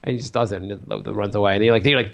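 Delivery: ordinary delivery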